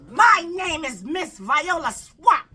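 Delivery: slow, loud, high menacing witch voice; hammed-up scratchy villain intro. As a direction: draw out the name like a mean teacher. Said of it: "she said with a scratchy voice".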